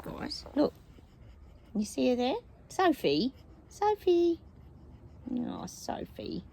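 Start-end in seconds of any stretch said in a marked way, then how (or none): 2.7-4.4 calling a dog by name. Real, not acted. 5.3-6.4 slow, soft whispered aside to the dog; whispering fondly to a pet dog. Real, not acted.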